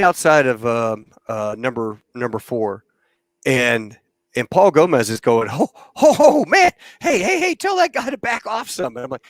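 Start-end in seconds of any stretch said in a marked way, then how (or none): 5.5-8.9 high, panicked calls to back off; alarmed yelling. Retold or imitated. Crew chief yelling about the jet too close. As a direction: yelp in alarm as the jet crowds in.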